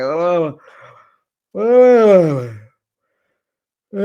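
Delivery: loud, stretched bored yawning "oh"; mock bored yawn. Dorit yawning at Camille, a loud mock-bored yawn. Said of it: "she starts yawning, like, bored".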